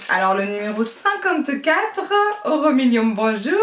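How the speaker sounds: loud, playful lotto-draw announcement; lottery announcer. Pretend. Vlogger playing lotto host for viewers, announcer voice. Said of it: "On se croirait au loto".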